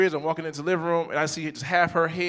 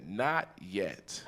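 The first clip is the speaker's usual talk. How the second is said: low, soft little reply; understated toddler impression; imitated toddler; 8 semitones lower, 10 dB quieter than usual. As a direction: quietly mimic a small child's answer. Imitates small daughter answering.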